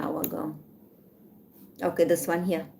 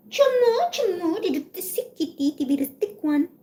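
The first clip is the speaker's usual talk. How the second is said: much higher sing-song call to a kitten; high cooing cat call; 11 semitones higher than usual. Real, not acted. Owner calling her sick kitty, high baby talk. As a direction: coo the cat's name up high.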